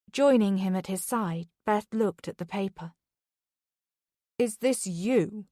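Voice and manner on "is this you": slow, stunned asking; slow question. Acted. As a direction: ask it slowly, in disbelief. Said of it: "Charlie asked slowly".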